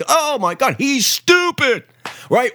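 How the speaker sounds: high, slow sing-song scoff at the media; mock media outrage. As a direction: croon it like a sneering parody of the pundits.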